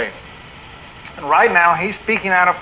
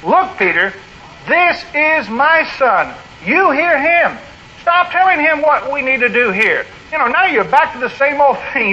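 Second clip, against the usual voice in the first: straight, direct fatherly rebuke; higher, insistent voice laying down the law; God the Father; 6 semitones higher than usual. Acted. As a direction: sternly set a disciple straight. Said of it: "That's pretty straight. That's pretty direct".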